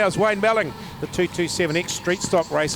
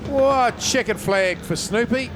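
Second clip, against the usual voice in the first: excited call; 6 semitones higher, 3 dB louder, slower than usual. Real, not acted. Race finish called live.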